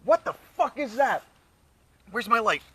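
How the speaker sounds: sudden high startled fear; panicked at a noise in the dark; found footage panic. Real, not acted.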